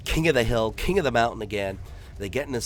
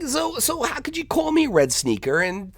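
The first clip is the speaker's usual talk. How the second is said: high, louder confused voice; puzzled kid; 13 semitones higher, 4 dB louder than usual. Retold or imitated. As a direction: demand it, bewildered. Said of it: "He's like".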